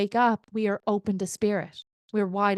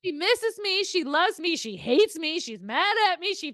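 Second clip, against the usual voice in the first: husband listing feelings, mocking; 8 semitones higher than usual. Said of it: "he goes, "Ange".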